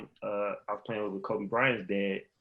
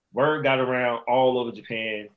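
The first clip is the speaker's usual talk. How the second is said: normal talking voice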